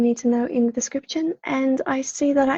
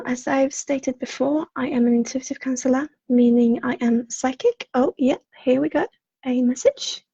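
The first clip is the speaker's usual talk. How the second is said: ordinary talk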